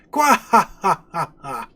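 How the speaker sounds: slow hammed-up evil cackle; slow scheming villain's cackle; villain laugh. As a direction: gloat with a drawn-out villain laugh. Villain gloating over kingdom.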